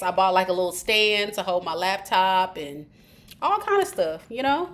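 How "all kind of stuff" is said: usual speaking voice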